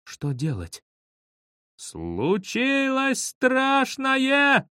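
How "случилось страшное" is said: very high, slow wail; high, stretched-out panicky call without extra volume; drawn-out cry. A narrator's reading. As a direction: cry it out, stretching every word. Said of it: "закричал я".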